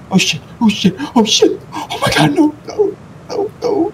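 crying out high, near tears; real fear, voice breaking into cries; terrified horror victim. Real, not acted. About to die in game.

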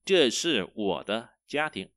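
slow, careful Chinese sentence; slow teacher demo; slow model reading. Shown as a demonstration. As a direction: read it slowly for copying. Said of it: "Đây là gia đình của tôi".

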